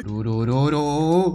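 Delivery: long wordless "rururu" sound; drawn-out trilled noise. Real, not acted. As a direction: draw out a low, slow "rurururu". Wordless noise while fleeing crocodile.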